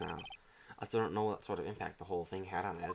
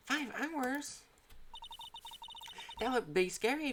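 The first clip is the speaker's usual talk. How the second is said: put-on worried girl sidekick; slower than usual. Sidekick reacts to the danger.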